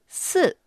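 す with "ee" lips, dropping from high; falling tone. Shown as a demonstration. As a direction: start す high and let it plunge. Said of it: "こんな感じです". (「い」の口で下がる「す」)

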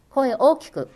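loud, emphatic advice. Shown as a demonstration. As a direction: belt 声大きく out like an instruction. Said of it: "大きな声で喋るのが一番大切".

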